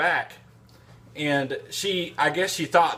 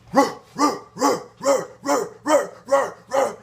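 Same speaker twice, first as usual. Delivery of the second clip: loud high barking, much higher; dog bark imitation; 10 semitones higher, 3 dB louder than usual. Man imitating bulldog barking heard over the phone, loud high barks. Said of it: "I could hear her over the phone".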